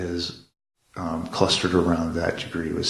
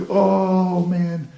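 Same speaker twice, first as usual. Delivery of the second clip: much higher, louder sighing exclamation; a big fond mock groan at the kid; exasperated oh man; 12 semitones higher, 6 dB louder than usual. Mother reacting to son's fear.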